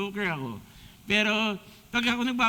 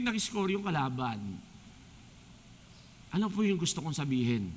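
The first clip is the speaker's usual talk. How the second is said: ordinary talk, nothing marked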